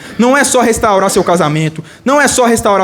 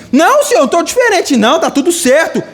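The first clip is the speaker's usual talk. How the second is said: high-pitched hammed-up denial; protesting Adam; 7 semitones higher than usual. Adam insisting to God nothing has changed, high comic denial. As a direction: leap up high in hasty protest, easing slightly.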